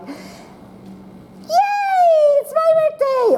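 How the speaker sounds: high, thrilled birthday cheer; excited birthday shout; happy excited. Shown as a demonstration. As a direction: squeal it like a delighted child. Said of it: "an excited sentence that's either happy".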